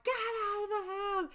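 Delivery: high, desperate scream. Retold or imitated. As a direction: scream it in panic, cry out. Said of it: "Elizabeth's like".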